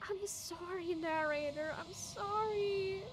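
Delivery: high, pleading apology; pained, pitying whine; sorrowful apology. Real, not acted. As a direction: plead forgiveness, drawing the last word out. Apologizing to dying game character.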